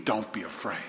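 soft, low incredulous echo; quiet wondering; hushed disbelief. Real, not acted. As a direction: say it almost to yourself.

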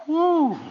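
high, loud woo; a sudden high whoop. Real, not acted. Exclamation after the passage.

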